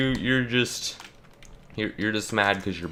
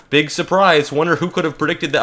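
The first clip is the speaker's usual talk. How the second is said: higher, louder sarcastic jab; 6 semitones higher, 6 dB louder than usual. Real, not acted. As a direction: drip with sarcasm at the obvious. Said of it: "sarcastic punchline".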